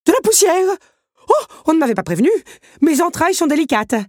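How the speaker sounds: high, loud fast fussy panic; panicking. Acted. Big Ben panicking about dust in his works. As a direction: clock in a flap over dust. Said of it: "paniqua-t-il".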